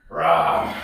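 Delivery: low, loud bear roar; playful monster growl. Pretend.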